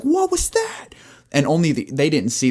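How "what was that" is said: high, startled squeak; startled cry. Acted. Boyfriend hearing the crash, startled high squeak. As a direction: jump at the noise and squeak it. Startled man after tree hits.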